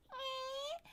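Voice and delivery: squeaky high cat noise, soft; happy cat sound. Retold or imitated. Voicing her cat stretching, a high happy squeak. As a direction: voice the cat's contented little squeak. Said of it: "all happy".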